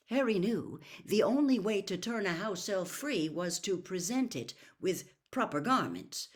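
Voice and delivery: usual speaking voice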